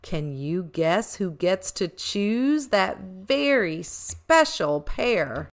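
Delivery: ordinary delivery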